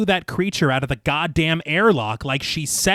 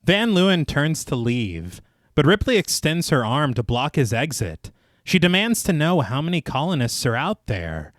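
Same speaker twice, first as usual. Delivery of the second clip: measured, lower narrator's read; story narration; 3 semitones lower, 3 dB quieter than usual. A narrator's reading. Narrator recaps the inquiry scene for listeners, measured storytelling. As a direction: read it like a polished audio storyteller. Reads scripted film recap.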